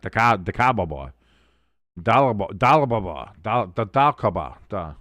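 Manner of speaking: "Decababa", two names mashed into babble; garbled blend. Retold or imitated. Streamer replaying slip of Dallas and Cowboys, garbled "Dalkaba". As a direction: stumble through "Dalaba-, Dalababa", syllables colliding and breaking off. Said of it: "I tried to say Dallas and Cowboys at the same time".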